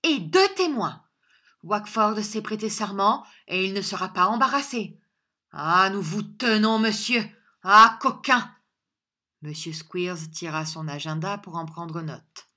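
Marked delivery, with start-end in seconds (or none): 0.0-8.5 loud, high gloating; exultant, raised-voice threat; triumphant. A narrator's reading. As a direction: crow over a cornered enemy. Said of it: "dit Squeers triomphant".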